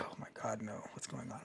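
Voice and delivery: near-whispered dismay, voice dropped away; aghast under the breath; hushed disbelief. Retold or imitated. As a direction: breathe it out in shock, almost silent. Dismayed aside at kid's answer.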